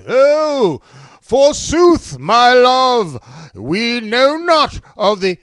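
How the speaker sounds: loud, high, drawn-out theatrical declaiming; hammy Shakespearean actor. Acted. Books on tape guy reading old verse, hammy declaiming. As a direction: declaim it grandly, stretching the words. Mock book reading for pay.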